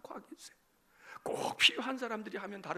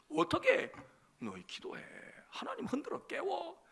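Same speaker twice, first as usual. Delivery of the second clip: ordinary delivery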